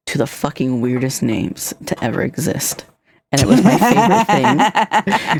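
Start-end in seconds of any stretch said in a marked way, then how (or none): 3.3-5.4 higher, louder, drawn out through laughter; laughing delight. Real, not acted. Streamer recalling a gift-sub giver, laughing loud and high. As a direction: burst out fondly, laughing and slowing down. Fond memory told over shared laughter.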